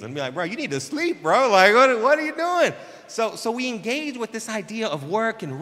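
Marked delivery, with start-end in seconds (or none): 0.3-2.7 much higher, much louder disbelief; incredulous big-brother scolding. Retold or imitated. Pastor scolding a sleepless church member in loud, high disbelief. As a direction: blow up in mock alarm at him.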